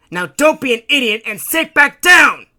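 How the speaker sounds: high, furious full-force shout; barked order. A narrator's reading. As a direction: bark him back into his seat. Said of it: "Bugsy barked".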